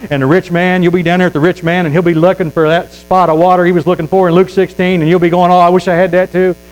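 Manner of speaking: loud, rising hellfire preaching; full-force revival preacher; fiery preaching. Acted. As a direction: preach it hard at the congregation.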